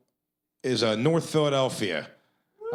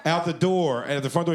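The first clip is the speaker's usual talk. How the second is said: normal talking voice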